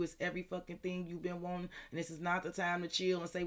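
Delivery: usual speaking voice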